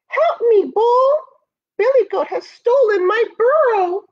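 high, loud cry for help; distressed badger. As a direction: plead urgently in a high-pitched badger voice. Badger pleads for help in story.

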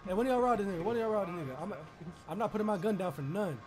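ordinary delivery

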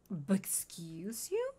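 whispered "But excuse you?" slowly, rising on "you"; slow hushed whisper of "But excuse you?"; offended whisper. Acted. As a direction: breathe "But excuse you?" as a slow, voiceless, indignant question. Offended reply to Oscar.

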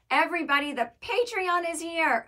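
loud, high proclamation; hyped announcement. Real, not acted. Host telling everybody about the Patreon, hyped announcement.

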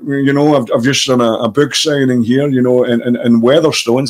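lightly voiced film star; halting, conversational actor impression; Sean Connery. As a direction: chat in an impersonated famous actor's voice. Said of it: "he's like that".

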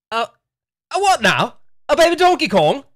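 high, loud, incredulous British voice; British disbelief breaking toward laughter; British accent. Pretend. Nintendo hearing the baby Donkey Kong idea, incredulous British accent. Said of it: "Nintendo has a British accent".

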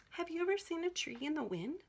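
usual speaking voice

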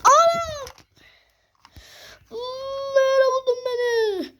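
very high shriek of fright. Acted. As the monster finds him, a very high frightened shriek. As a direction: shriek like you're grabbed. Caught again.

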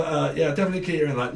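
normal talking voice

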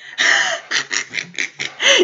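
breathy high-pitched giggle burst; high squealing laugh. Real, not acted. Squealing laugh at the anime scene.

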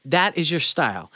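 ordinary talk, nothing marked

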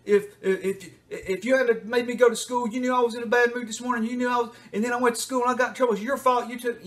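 high, loud, rushing accusation; aggressive outburst. Retold or imitated. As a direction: attack the parent. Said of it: "they get really aggressive".

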